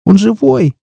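put-on a frightened girl's squeak. A narrator's reading. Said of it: "пискнула девушка". (писк, очень высоко)

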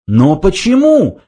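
high, drawn-out question; demanding. A narrator's reading. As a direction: demand an explanation. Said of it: "потребовала Триллиан".

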